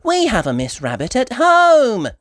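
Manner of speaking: put-on high little girl voice; wondering child; Peppa.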